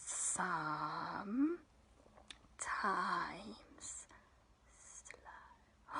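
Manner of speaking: dragged out, low, fading to a whisper; slow it right down to nothing; very slow and hushed. Shown as a demonstration. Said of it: "And then we go".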